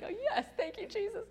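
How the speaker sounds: very high, gushing thanks; delighted. Retold or imitated. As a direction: squeal your thanks. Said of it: "We're like".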